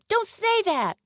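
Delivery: put-on high, upset child's protest; crying out. A narrator's reading. Little girl told she can't come along, high upset cry. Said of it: "cried the little girl".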